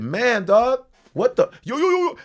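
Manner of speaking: mock street voice. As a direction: ham up the caricature. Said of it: "I said".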